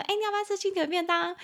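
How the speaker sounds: high, quick, chirpy friend's question; bright offer of a chicken-leg lunchbox. Pretend. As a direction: sound like a cheerful friend offering food.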